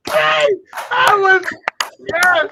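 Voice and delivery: loud, high excited outburst; excited shout. Real, not acted. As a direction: blurt it out, worked up. Excited shouting after laughing.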